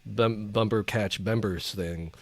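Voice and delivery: usual speaking voice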